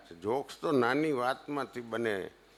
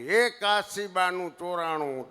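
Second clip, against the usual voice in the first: mock alarm call, a bit higher and slower; retold midnight shout; 6 semitones higher than usual. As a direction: call out that something was stolen, half-hammed. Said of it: "રાડ પડી". (ટ્રેનમાં અડધી રાતે પડેલી રાડની હળવી નકલ)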